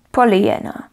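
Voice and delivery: ordinary delivery, nothing marked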